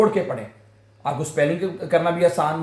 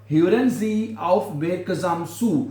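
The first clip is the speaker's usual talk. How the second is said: Hören Sie aufmerksam zu, very slow and clear; slow demo; much slower than usual. Shown as a demonstration. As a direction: model Hören Sie aufmerksam zu slowly for the class. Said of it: "Hören माने सुनो". (धीमा, साफ़ उच्चारण)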